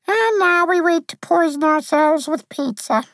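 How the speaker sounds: very high little-creature voice, deadpan; tiny high voice, evenly delivered; flat, adorable Lacuna. A narrator's reading. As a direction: say it like an adorable, unimpressed sprite. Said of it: "said in her flat, adorable way".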